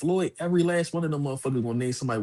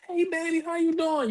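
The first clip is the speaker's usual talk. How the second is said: much higher sweet auntie voice; imitating Aunt Mary; 14 semitones higher than usual. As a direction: coo it like an old aunt on the phone.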